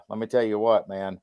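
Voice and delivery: usual speaking voice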